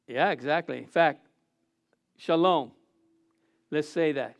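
ordinary delivery

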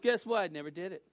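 ordinary talk

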